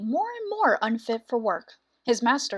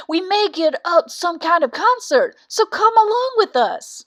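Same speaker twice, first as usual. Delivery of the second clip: louder, higher donkey talking him round; coaxing donkey; 5 semitones higher, 6 dB louder than usual. Acted. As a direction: urge him along with gusto. Ass urges the cock along.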